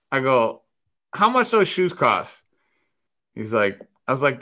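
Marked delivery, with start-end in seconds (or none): 1.1-2.4 high, slow, drawn-out question; nosy question sung out high; calling a question over. Retold or imitated. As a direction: ask across the room, pitched well up. Retold question called across room.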